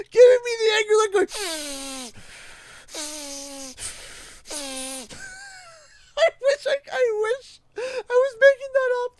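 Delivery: high, loud laughing through words. Real, not acted. Telling the principal scene while laughing.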